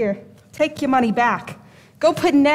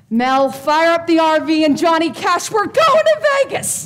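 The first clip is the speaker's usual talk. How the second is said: exultant call, climbing to a high shout; excited calling out; 3 semitones higher, 4 dB louder than usual. Acted. Fortune teller calling to Mel as she leaves, excited shout. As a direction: holler the big news, building to the end.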